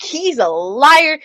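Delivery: high, drawn-out retold accusation; mock accusation. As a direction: stretch out the accusation, imitating a squabble. Voices kid's accusing comeback in retelling.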